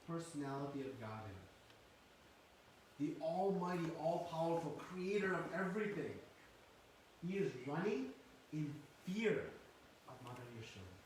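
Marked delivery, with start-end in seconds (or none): none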